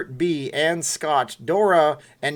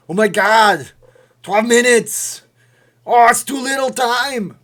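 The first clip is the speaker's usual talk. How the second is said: loud, high-pitched hammed-up alarm; mock panic; 9 semitones higher, 10 dB louder than usual. Streamer sees only twelve minutes left, mock panic. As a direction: cry out in exaggerated dismay at the clock.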